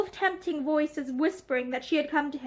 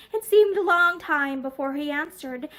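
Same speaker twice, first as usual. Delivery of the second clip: plain reading voice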